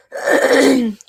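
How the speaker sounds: a loud rough throat clear. Real, not acted. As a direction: clear your throat hard. Hoarse voice.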